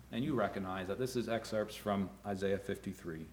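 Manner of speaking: ordinary talk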